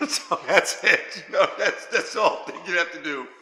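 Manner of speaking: talking through a real laugh. Real, not acted. Streamer amused after showing how to tighten the belly, laughing. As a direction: chuckle your way through the words.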